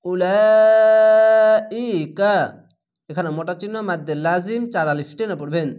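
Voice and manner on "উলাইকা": very long drawn-out louder chant; Quran recitation. Shown as a demonstration. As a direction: hold the vowel for four counts, ringing out. Four-count madd stretch recited. (চার আলিফ টেনে জোরে তিলাওয়াত)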